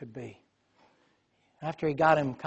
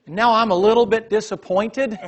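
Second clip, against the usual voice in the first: loud, high, laughing confession; 8 semitones higher, 8 dB louder than usual. Real, not acted. Preacher admitting to the congregation he wanted dad thrown, laughing. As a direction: laugh through it. Laughing at his own disappointment.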